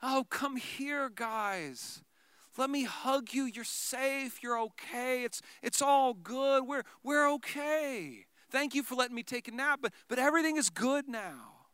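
soft, slow reassuring voice; tender comfort. Pretend. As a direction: soothe frightened friends gently. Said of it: "we expect him to go".

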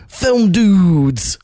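high, drawn-out excited announcement; excited name pitch. Retold or imitated. Host reveals a friend's suggested podcast name, hammed-up excitement. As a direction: stretch each word with gleeful, hammed-up excitement. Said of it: "the excitement that he put into saying".

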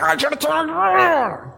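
high, distorted comic growl-yell; frustrated outburst. Acted. Driver venting at a late GPS direction, exasperated. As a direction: stretch it into a mock roar of frustration.

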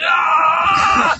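long, loud, high effort yell; hammed-up scream of exertion; straining yell. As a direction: roar as if heaving a mountain. Acting out pushing mountains.

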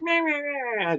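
high, soft nagging grandmother babble; a mocking parody of her nagging. Grandson mocks his grandmother's yelling as high, soft babble. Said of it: "My grandmother's more just".

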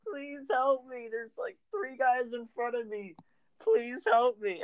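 high, slow, pleading cry for help; mock pleading. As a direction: beg your teammate for help, way up high. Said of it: "I'm like".

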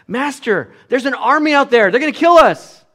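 servant shouting in panic, high and loud; frantic alarm at the army outside; panicking. Retold or imitated. Servant warns his master of the army in panic. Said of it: "he's panicking now".

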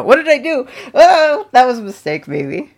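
high, loud flustered alarm; panicked surprise. Real, not acted. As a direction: caught off guard, half laughing. Game freezes on exit.

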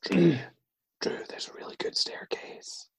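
soft, eerie coaxing whisper; ghostly whisper. Acted. Ghost luring Drew to the stairs, eerie whisper. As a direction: whisper it temptingly into someone's ear. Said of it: "she is known to whisper your name".

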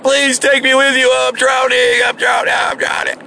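high, hammed-up cries for rescue, fading out; mock panicked pleading. Doubter begging Noah while drowning, mock panic. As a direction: beg to be saved in exaggerated panic.

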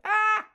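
short high-pitched mock scream. Shown as a demonstration. Showing the scream she didn't use, high mock scream. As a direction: let out a quick, high "ah" shriek. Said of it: "I wasn't screaming like".

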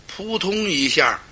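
ordinary delivery, nothing marked

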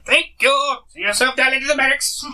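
put-on high, fast old crone voice. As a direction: rattle it off like a busy crone. Crone dismisses them to barracks.

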